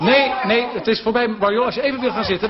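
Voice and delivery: loud, high-pitched host cutting in; calling time. Real, not acted. Host stops Marjolein as time is over, loud and urgent. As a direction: call a halt over the commotion.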